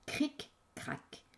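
hushed whisper. Acted. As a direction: breathe it like a secret. Storyteller's opening formula.

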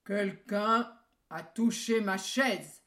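big bear bellowing, loud and slow; grosse voix du grand ours. A narrator's reading. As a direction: roar it like a huge papa bear. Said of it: "s'écria de sa grosse voix". (grosse voix d'ours qui tonne)

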